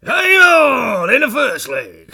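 high, loud, slow announcer bellow; darts MC shout. Retold or imitated. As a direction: call it like a darts MC. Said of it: "he shouts".

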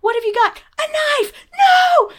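high, rising panic to a shouted end; escalating mock-horrified yelp; alarmed parent. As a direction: act a parent spotting danger. Acts parent catching toddler with something.

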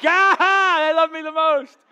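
loud, high mock victory yell; hammed-up shout of gloating joy; triumphant crowing. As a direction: crow like you just won the contest. Dad crowing over winning.

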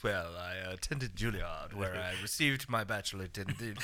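soft, low, slow pompous brag; lightly voiced low snob; snooty actor. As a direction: drawl it like a snob. Mock pompous actor credentials.

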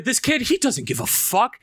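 ordinary talk, nothing marked

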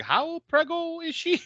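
high, slow, put-on priest voice; worried priest. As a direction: ask it slowly, way up high. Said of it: "They're like".

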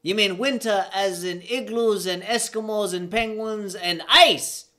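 high, loud, incredulous Jamaican voice; Jamaican accent. Acted. Teammate learns of the ice, heightened Jamaican accent. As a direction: build to a big shout on ice, in Jamaican accent. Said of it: "I gotta heighten my voice right now".

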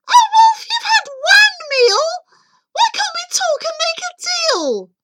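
very high, loud panicked squeal; terrified little pig bargaining at full pitch. Acted. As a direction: plead in a piercing piggy squeal. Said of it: "began to squeal. He cried".

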